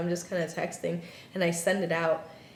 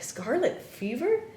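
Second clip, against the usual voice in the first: retold disbelief, higher pitch; incredulous question; 8 semitones higher than usual. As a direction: echo the diagnosis as a baffled, high question. Shocked reply to the diagnosis.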